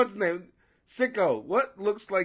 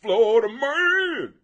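Southern accent; 3 semitones higher, 6 dB louder, slower than usual. Shown as a demonstration. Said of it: "you gotta do it with a Southern accent".

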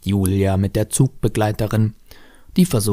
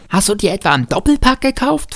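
put-on high-pitched little-boy question; incredulous child; 12 semitones higher, 4 dB louder than usual. A narrator's reading. Boy quizzing stepfather about candlestick, high incredulous voice. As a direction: ask it high, like an amazed child.

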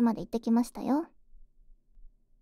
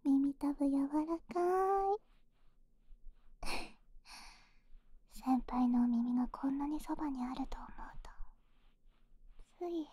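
soft close whisper; right in your ear; 4 dB quieter than usual. Acted. Whispering during ear massage.

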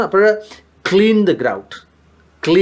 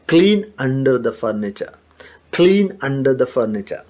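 slow model phrase; 2 semitones lower, much slower than usual. Shown as a demonstration. Teacher modelling a new word slowly for students. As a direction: stretch out each word of declutter the closet. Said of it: "ഫർണിച്ചറുകളുടെ അടി തൂക്കുന്ന".

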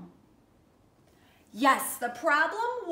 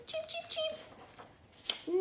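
bird cheeps; 13 semitones higher, 11 dB quieter, much slower than usual. Acted. Birds flying off chirping.

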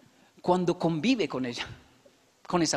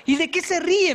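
wife scolding; 10 semitones higher, 6 dB louder than usual. Retold or imitated. Wife asks, voiced higher, louder.

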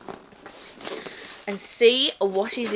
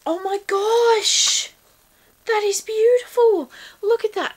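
awed delight; 9 semitones higher than usual. Real, not acted. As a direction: linger on it, enchanted. Awed by the sun catcher.

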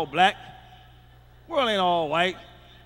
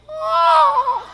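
high, loud mock cry of pleasure; mock high sex cry; 8 dB louder than usual. Imagining a Chinese wife in bed, a high mock cry. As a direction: yelp it out in a high squeal. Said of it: "so I can hear".

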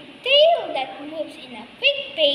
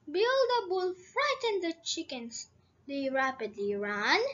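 plain reading voice

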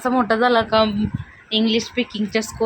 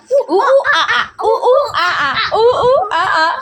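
high, loud monkey hooting with laughter; monkey noises; 11 semitones higher, 4 dB louder, much slower than usual. Acted. Showing off the monkey sound for viewers, high laughing hoots. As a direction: whoop like an excited monkey, high-pitched. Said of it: "आवाज पण काढते बरोबर बघा".

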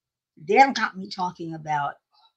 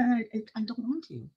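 yawning; 4 semitones higher, 9 dB quieter than usual. Real, not acted. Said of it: "I can't stop yawning".

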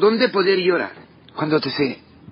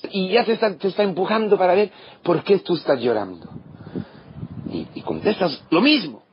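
ordinary talk, nothing marked

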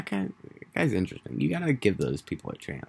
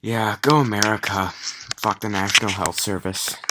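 louder, higher hammed-up cheer for America; mock patriotic shout; 5 semitones higher, 8 dB louder than usual. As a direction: belt it out like a jokey flag-waver. Mock patriotic rant against Brits.

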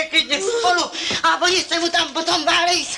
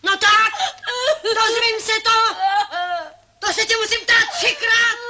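higher, sobbing and shouting; crying; 7 semitones higher than usual. Acted. Grandmother demanding to know who the father is, crying and shouting. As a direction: shout it through tears. Grandmother upset at pregnancy news.

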